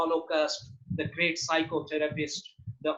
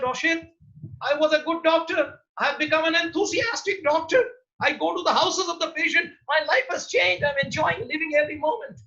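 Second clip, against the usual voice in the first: retold doctor, much higher and bubbling over; excited doctor; 9 semitones higher, 3 dB louder than usual. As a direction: gush like a converted doctor. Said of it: "He said".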